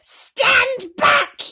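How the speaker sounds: very high, loud shriek; shouting. A narrator's reading. Aunt warns everyone back from the tree, shrieking. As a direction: scream the warning. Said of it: "Aunt Sponge shouted".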